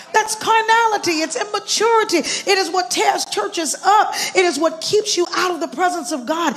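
high, driving pulpit rebuke; forceful preaching. Real, not acted.

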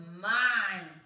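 loud, drawn-out wail. A narrator's reading. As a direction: wail it out at full volume. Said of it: "and he wailed".

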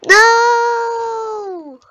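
sudden high, loud cry of "No!"; frustrated shout. Real, not acted. Gamer yelling at a missed jump, loud cry. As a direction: yell it as the jump fails.

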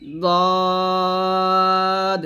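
দদ thick, with its vowel held very long; sustained dad. Shown as a demonstration. Teacher demonstrating the letter's sound, long and level. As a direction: stretch a heavy দদ on one steady note. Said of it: "হরফ উচ্চারণটা এরকম".

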